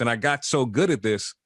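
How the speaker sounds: ordinary talk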